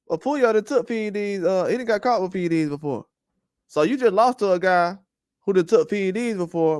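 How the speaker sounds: usual speaking voice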